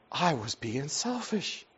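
slow, soft, imitated guilty child; about to cry. Broken son admitting fault to dad, on the verge of crying. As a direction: confess it quietly, dragging out the words. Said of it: "And he started crying".

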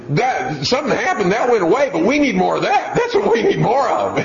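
high woman's voice with laughter; approving older lady. Retold or imitated. Dad quoting the lady praising discipline, high and laughing. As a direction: gush approval through a chuckle.

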